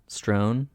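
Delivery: "Strown" with an oh vowel; alternative pronunciation. Shown as a demonstration. Checking a word with co-host, "Strown" with oh. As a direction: try out "Strown" to rhyme with "grown". Trying out how to say strewn.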